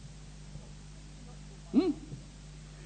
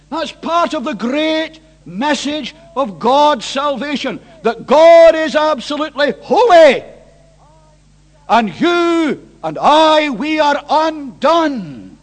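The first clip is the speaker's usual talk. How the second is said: slow, raised proclamation; emphatic preaching; 6 semitones higher, 12 dB louder, slower than usual. Real, not acted. Preacher telling congregation they are undone, slow loud proclamation. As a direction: ring out each weighty word with force.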